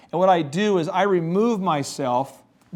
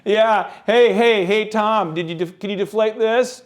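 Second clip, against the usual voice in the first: raised, louder taunting fan voice; mock heckling; 4 semitones higher, 4 dB louder, slower than usual. Strangers teasing a Brady fan, mock heckling. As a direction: needle him like a stranger spotting the jersey.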